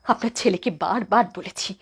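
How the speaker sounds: ordinary delivery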